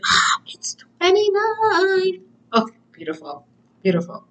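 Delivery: high breathy whispered aside; airy whisper. Real, not acted. Tarot reader whispering the card number to herself, high and airy. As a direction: breathe it out in surprise.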